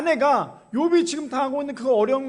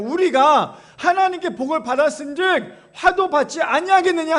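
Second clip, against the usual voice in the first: loud, higher, emphatic scripture quote; Job's declaration; 6 semitones higher, 7 dB louder than usual. Acted. Job answering his wife, loud emphatic declaration. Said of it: "욥이 그래서 뭐라고 얘기합니까?".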